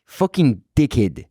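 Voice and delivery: put-on a light South African accent on the swearing; lightly done South African insult. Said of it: "I've been working on a South African accent".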